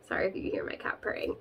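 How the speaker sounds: quick whispered aside; hushed aside. Real, not acted. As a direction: rush it under your breath. Quick aside about purring cat.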